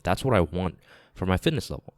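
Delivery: normal talking voice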